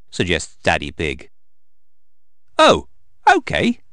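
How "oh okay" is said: put-on high, louder child's voice; high-pitched, narrated child character; voiced little pig. A narrator's reading. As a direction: pipe it up like a little girl agreeing. Reluctant child agrees to give toy.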